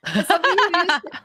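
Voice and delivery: half laughing as she starts over. Real, not acted. Laughing restart of sentence.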